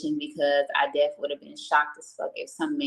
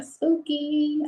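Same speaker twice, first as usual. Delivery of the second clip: high-pitched spooky; 11 semitones higher than usual. Real, not acted. Recalling scary encounter.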